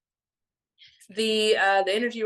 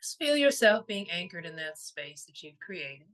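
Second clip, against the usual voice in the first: guided meditation; 3 semitones lower than usual. Real, not acted. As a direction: gently guide them into stillness, letting each phrase settle.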